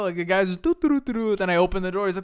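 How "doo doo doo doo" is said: high, loud sing-song doos; carefree doo-doo humming. Retold or imitated. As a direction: imitate a worker obliviously tootling along. Imitates painter humming obliviously at work.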